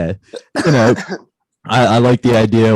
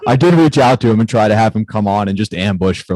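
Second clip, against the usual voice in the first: ordinary delivery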